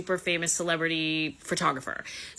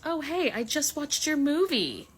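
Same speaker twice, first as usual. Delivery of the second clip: higher and slow, a catty mock Martha; mock Martha, dead-faced; 8 semitones higher than usual. Martha needling Bethany about her movie, higher slow mock sweetness. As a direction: drag it out sweetly, higher and slower, the dig disguised. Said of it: "Martha looks her dead in the face and goes".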